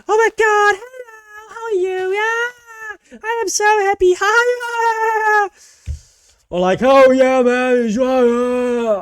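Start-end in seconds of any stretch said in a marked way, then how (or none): 0.1-5.5 shrieking, much higher and louder with fake glee; mock a bubbly high-energy girl greeting chat; squealed hammed-up hello; over-the-top hyper streamer girl. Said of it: "people go like". 6.8-9.0 much higher, louder hyped-up bro yell; hammed-up hype shout; mock yelling streamer. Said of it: "all that yelling".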